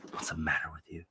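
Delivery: low disapproving scold. Retold or imitated. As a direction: scold her, low and stern. Scolding character quote.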